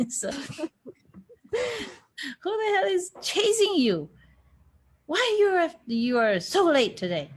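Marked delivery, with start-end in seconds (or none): 2.4-7.3 high-pitched mock telling-off; imitating a scolding. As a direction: play the scolding teacher, high. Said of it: "the way he used to scold".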